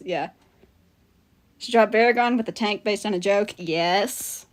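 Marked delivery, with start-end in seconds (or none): none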